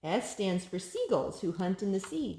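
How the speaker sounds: lilting picture-book read-aloud; sing-song storybook reading; reading to a child. Acted. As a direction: read each rhyme brightly to a toddler.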